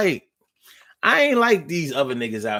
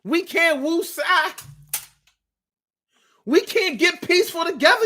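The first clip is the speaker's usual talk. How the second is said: high mock dismay; 13 semitones higher than usual. Mock dismay at yoga ban.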